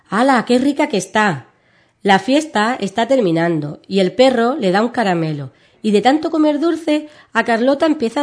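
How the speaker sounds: put-on lively children's story reading; storytelling for kids. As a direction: read it like a bedtime tale for little ones. (lectura de cuento infantil)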